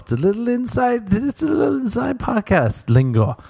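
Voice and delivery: very high, sing-song squeak; tiny squeaky in-joke voice; squeaky aside. Acted. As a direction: jump up into a silly high-pitched voice. Jokey aside on in-joke lingo.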